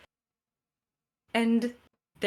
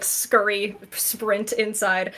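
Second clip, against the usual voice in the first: ordinary delivery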